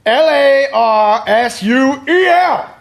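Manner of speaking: high, slow shouted spelling; spelled out loud. Acted. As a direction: belt out each letter slowly. Spelling name loudly.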